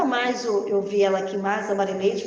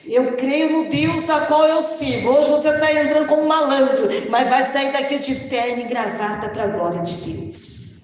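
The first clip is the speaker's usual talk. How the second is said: preaching; 4 semitones higher, 5 dB louder than usual. Acted. Preaching declaration to the congregation.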